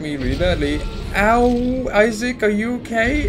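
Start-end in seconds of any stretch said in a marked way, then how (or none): 1.2-3.3 high, hammed-up cry of concern; mock-alarmed concern. Player checking on hurt Isaac, mock-alarmed concern. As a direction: squeal with playful alarm. Voices concern for own character.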